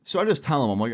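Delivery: ordinary delivery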